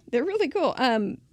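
ordinary talk

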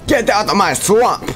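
high, loud yelled swamp line; Shrek shout. Pretend. Gamer yelling at enemy players, Shrek yell. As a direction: bellow it like an angry ogre. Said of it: "Hashtag Shrek's swamp".

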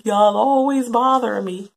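annoyed whine. Retold or imitated. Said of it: "You'll be like".

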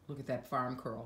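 usual speaking voice

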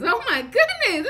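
high, loud laugh of disgust; oh my goodness, half laughing. Real, not acted. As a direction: laugh through the shock of the taste. Tasting disgusting baby food.

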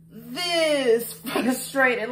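very slow, drawn-out "this frustrated" with a laugh; exaggerated frustration. Shown as a demonstration. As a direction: drag it out for the punchline, laughing. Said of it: "The answer is".

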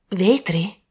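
mildly astonished one-word question. Acted. Wife reacts to glass in the pudding, mildly astonished. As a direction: echo the word in mild disbelief. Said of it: "disse la signora Lacy sbalordita".

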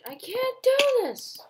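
high, loud cry of rage; frustrated yell. Real, not acted. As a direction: scream it at the screen. Raging at the game.

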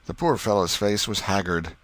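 ordinary delivery

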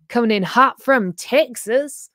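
slow, drawn-out mock Texan accent; a playful stab at a Texas drawl; hammed-up Texan twang, said slowly. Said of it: "sorry if I butchered the accent".

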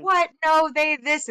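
high and loud, mock disbelief; incredulous friends. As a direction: toss it back like a shocked friend. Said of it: "And everybody was like".

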